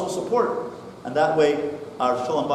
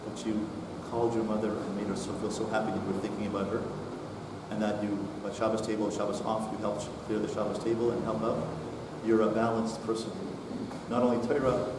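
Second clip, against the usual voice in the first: soft, low, sincere words of pride; tender praise; 8 semitones lower, 9 dB quieter than usual. Retold or imitated. Father praising his son at the Shabbos table, tenderly. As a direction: tell him quietly how proud you are. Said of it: "with a tone of one voice".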